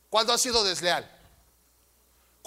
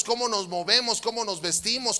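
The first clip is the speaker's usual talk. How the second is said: ordinary talk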